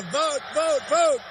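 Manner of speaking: loud rally exhortation; rally speech. Real, not acted. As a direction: rouse the crowd to vote. Rally crowd chant build-up.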